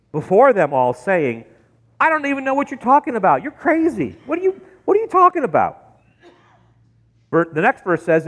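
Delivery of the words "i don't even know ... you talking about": high, rushed protest; incredulous denial. Acted. Peter denying before them all, high and incredulous. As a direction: deny it fast, pitch climbing as you go. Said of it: "But he denied it".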